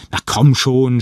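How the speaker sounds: loud, exasperated outburst; shouting. A narrator's reading. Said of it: "schrie ich".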